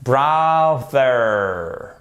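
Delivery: "brother" stretched out with a long voiced TH; hard TH. Shown as a demonstration. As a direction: hold the buzzing TH in "brother", very slowly. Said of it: "c'est un hard T H".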